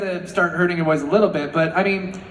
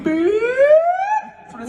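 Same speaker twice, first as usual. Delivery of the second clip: imitated beep; 3 dB louder, much slower than usual. Imitating strength-tester bell beep.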